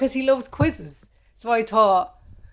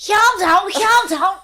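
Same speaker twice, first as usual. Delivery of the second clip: high, loud Scouse; hammed-up Scouse catchphrase in a Liverpool accent; 10 semitones higher, 8 dB louder, slower than usual. Pretending to be a swimmer from Liverpool, high Scouse accent. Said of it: "pretending I'm from Liverpool".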